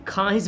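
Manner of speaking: ख्वाहिश as "khaahish", no "w"; silent w. Shown as a demonstration. Teacher giving the spoken form, ख्वाहिश with silent व. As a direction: drop the व in ख्वाहिश. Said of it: "बोलते हैं".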